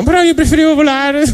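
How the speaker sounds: high squeaky fish complaining; whiny fish. Acted. Fish answering God, high whiny fish voice. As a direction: pout it out like a let-down little fish. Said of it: "Altri pesci dicono".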